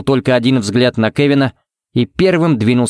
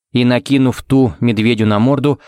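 plain reading voice

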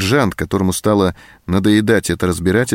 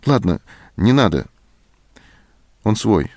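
plain reading voice

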